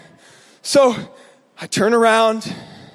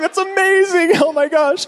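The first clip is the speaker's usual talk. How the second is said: high-pitched, giddy retold amazement; excited celebration; 6 semitones higher than usual. Teenage friends cheering a successful egg throw, giddy and excited.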